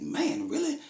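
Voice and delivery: very high incredulous exclaim; disbelief. Retold or imitated. Father reacting to old men still thugging, high disbelieving exclaim. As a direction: sound amazed and disgusted.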